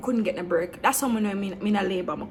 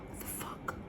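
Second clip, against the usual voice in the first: whispered disbelief; 20 dB quieter than usual. Retold or imitated. Disbelief, nurses refuse C-section.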